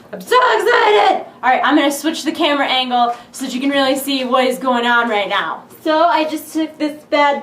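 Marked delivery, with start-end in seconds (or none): 0.1-1.2 high loud burst of joy; yelling with unboxing excitement; excited shout. Real, not acted.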